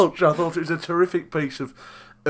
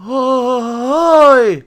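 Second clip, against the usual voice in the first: single higher, louder "Oi"; one cocky shouted oi; imitating catchphrase; 9 semitones higher, 8 dB louder than usual. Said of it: "now it's like".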